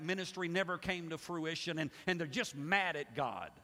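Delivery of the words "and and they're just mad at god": ordinary talk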